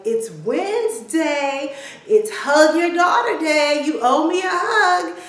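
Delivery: high, loud, drawn-out announcement; excited daughter. Retold or imitated. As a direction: claim the hug like a gleeful kid. Daughter announces hug day, acted.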